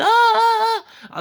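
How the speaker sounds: high-pitched mock moans, loud, through the wall; high moaning. Moans heard from a room in the porn corridor, high and loud. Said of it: "à gauche, ça fait".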